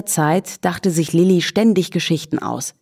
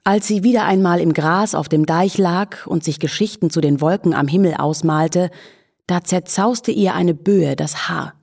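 ordinary delivery, nothing marked

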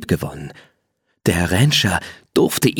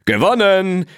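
much higher, crowing win; triumphant neigh; 11 semitones higher than usual. Acted. Blackie crossing the finish first, crowing triumphantly. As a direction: shout the victory like a boastful horse. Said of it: "wieherte er".

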